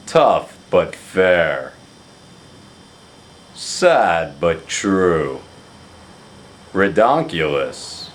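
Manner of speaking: a light mock of a friend's slow pet phrases; slow catchphrase imitation; Craig's dinerisms. Shown as a demonstration. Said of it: "I can repeat a few of those for you".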